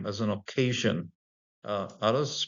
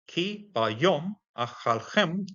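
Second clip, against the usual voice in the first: slow scripture reading; 7 semitones higher, much slower than usual. Shown as a demonstration. Reads the Hebrew verse aloud.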